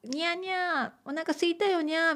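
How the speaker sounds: high, soft kitten-like meow talk; cute cat voice. Acted. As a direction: purr it out like a begging kitten. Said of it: "みたいな可愛い声を出す".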